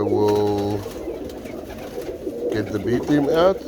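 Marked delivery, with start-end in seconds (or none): none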